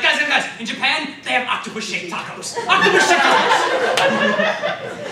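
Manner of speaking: high, loud, laughing, stretching "Octopus-shaped tacos"; giddy announcement. Retold or imitated. The character telling his friends about octopus tacos, high and loud. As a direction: announce it bursting with glee.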